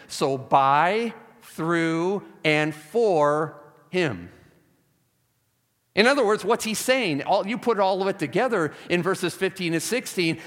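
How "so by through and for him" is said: BY, THROUGH and FOR each held long and set apart; emphatic listing. Shown as a demonstration. Preacher summing up the three prepositions, slow and emphatic. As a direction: slowly land on BY, THROUGH, FOR with pauses.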